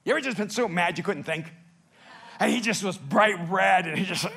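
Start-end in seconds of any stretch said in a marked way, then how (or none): none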